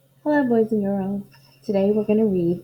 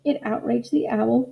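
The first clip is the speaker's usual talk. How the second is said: ordinary delivery, nothing marked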